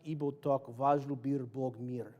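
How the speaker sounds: slow careful Russian take; Russian, plain. Shown as a demonstration. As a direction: say the Russian words slowly and straight. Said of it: "first I gotta say it in Russian".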